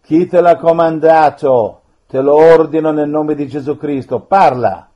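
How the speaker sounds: loud, forceful orders; stern command. Real, not acted. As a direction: command it to speak. Priest commanding a spirit.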